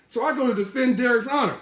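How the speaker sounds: usual speaking voice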